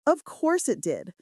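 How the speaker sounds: ordinary delivery, nothing marked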